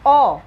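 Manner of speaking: emphatic "ओ" for the class; vowel demo. Shown as a demonstration. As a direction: call out "ओ" as a clear open vowel. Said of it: "इसमें ए का pronunciation क्या है".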